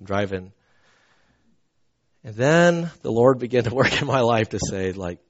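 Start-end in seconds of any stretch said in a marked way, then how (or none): none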